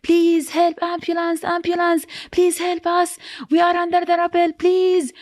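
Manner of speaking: retold high-pitched plea for help; urgent, high cries without full shouting; screaming for help. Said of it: "screaming for help".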